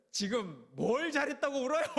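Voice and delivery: missionary scolding the crying ladies. Retold or imitated. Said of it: "그런 거예요". (우는 권사님들을 나무라는 호통)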